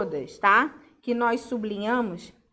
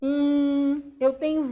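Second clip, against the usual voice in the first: usual speaking voice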